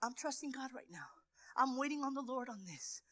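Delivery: soft, near-whispered trust; soft hushed reassurance; hushed calm confiding. Shown as a demonstration. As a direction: tell the kids quietly, steadily. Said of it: "It's okay to tell your kids".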